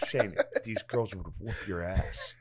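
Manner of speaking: slow, soft mock dad voice; a lightly put-on taunting father; imitating his dad. Son retelling his dad's boast, slow and hushed. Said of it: "And he's like".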